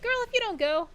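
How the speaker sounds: sassy urging. Retold or imitated. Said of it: "I'm like".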